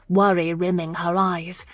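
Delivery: ordinary delivery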